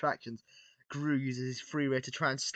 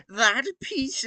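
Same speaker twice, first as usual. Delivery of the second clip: put-on higher, louder stab at a villain accent; Gru voice attempt; 9 semitones higher, 7 dB louder than usual. Villain demanding the Lego piece, tentative accented try. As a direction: try out a supervillain accent, half-sure. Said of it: "I can't do his voice".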